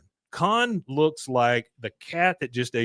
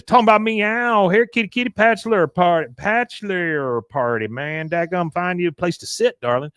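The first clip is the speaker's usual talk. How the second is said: Patch impression; 6 semitones higher than usual. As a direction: ham up a sleazy flirt. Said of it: "and says".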